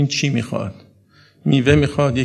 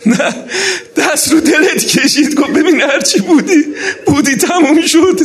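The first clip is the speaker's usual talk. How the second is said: very high, loud lamenting cry; raised, emotional mourning-sermon delivery; impassioned tearful preaching; 9 semitones higher, 7 dB louder than usual. Acted. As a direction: preach at full force, nearly wailing. Preacher's climactic tearful exhortation.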